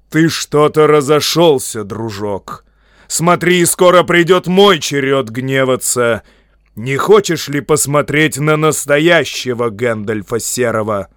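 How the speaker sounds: loud, commanding wizard's warning; stern, forceful threat; threatening anger. A narrator's reading. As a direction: raise your voice in mounting menace.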